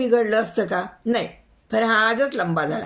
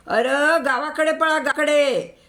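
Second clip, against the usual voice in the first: high shout to run to the village; 7 semitones higher than usual. A narrator's reading. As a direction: yell the order to flee, pitched up. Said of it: "Patil ओरडले". (पाटील सगळ्यांना ओरडून गावाकडे पळायला सांगतात)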